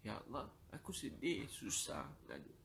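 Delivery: plea fading to a whisper. Acted. Prayer fading into sobbing breath.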